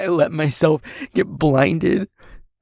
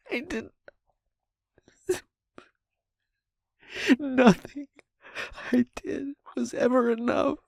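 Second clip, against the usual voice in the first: high, hushed voice on the edge of sobbing; breaking down; 7 semitones higher, 4 dB quieter than usual. Acted. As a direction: let the voice climb and crumble into tears. Breaking down into sobs.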